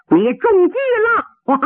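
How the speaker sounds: high, slow crowing; spring the trap with relish, then laugh; triumphant gloating. Acted. Yu Hualong telling his rival of the trick, high triumphant gloating.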